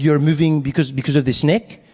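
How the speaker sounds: puzzled Frenchman's English; French accent. Acted. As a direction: ask it in a baffled French accent. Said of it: "the French guy".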